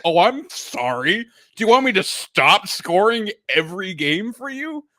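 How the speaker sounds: indignant sarcasm, voice climbing; mock apology. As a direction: act out an affronted player's retort. Voicing Matthews's sarcastic retort to coach.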